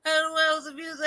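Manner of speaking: high, loud, cracking up; real laughing. Real, not acted. Host admitting amusement at the clip, laughing hard. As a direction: squeeze the words out through laughter.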